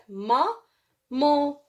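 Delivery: "ma, mon" split by a clear pause; syllable by syllable. Shown as a demonstration. Teacher repeating the word for pupils, separated syllables. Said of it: "Je répète".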